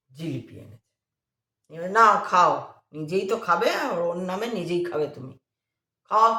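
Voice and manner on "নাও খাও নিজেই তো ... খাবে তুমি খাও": sneering. Retold or imitated. Angry man sneers handing jilipi.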